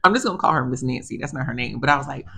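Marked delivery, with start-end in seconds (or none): none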